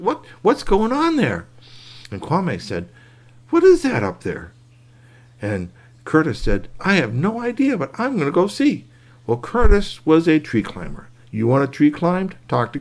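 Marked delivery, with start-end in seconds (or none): 0.0-1.4 much higher, slower puzzled question. Acted. Boy staring up at the wriggling nest, high puzzled voice. Character voice, curious. 3.5-4.5 much higher, a bit louder question; high curious. Acted. Kwame looking up at the nest, high curious call. 6.8-8.9 put-on much higher, eager boy; bright determined kid. Curtis deciding to climb, high eager kid voice.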